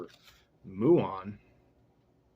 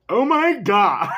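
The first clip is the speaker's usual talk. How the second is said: high, loud yell breaking into laughter; shouted cry cracking up; 10 semitones higher, 12 dB louder, slower than usual. Acted. Reading what all people call out, laughing mock shout. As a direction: belt it out, then crack up.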